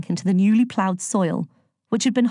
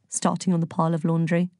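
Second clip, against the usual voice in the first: ordinary delivery, nothing marked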